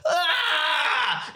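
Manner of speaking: cartoonish pained cry on being hit; hammed-up death cry. Shown as a demonstration. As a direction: yelp like you just got shot. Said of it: "When I get shot, I go".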